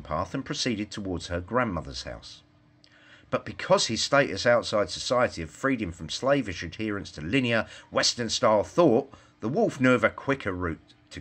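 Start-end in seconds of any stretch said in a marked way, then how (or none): none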